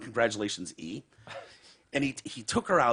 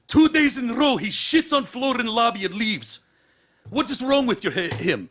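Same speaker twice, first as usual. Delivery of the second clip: angry hotel manager; 11 semitones higher, 7 dB louder than usual. Retold or imitated. Manager accuses.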